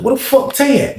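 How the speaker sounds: high-pitched, puzzled demand; retold bewilderment. Storyteller looking round for his missing friend, retold bewilderment. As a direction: ask it high, as if caught off guard. Retelling his startled question.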